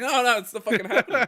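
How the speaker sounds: high, loud sarcastic no; hammed-up loud refusal; mock refusal. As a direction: scoff it at the customer. Refusing customer, mocking.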